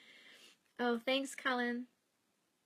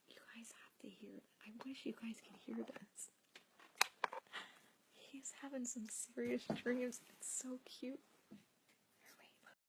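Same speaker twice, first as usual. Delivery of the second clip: hushed so the dreaming cat stays asleep; soft breathy whisper; 2 semitones higher, 5 dB quieter than usual. Real, not acted. Whispering to viewers beside her sleeping cat.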